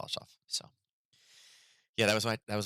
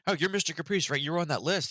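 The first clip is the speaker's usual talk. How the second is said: mock eager principal; 9 semitones higher than usual. Assistant principal recognising him.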